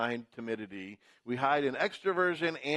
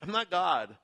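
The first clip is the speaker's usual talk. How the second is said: Joseph reassuring; 6 semitones higher, slower than usual. Retold or imitated. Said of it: "he says to them".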